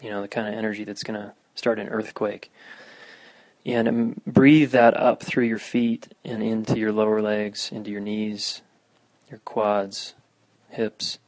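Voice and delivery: guided meditation. Acted. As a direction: guide listeners through grounding. Leads a meditation.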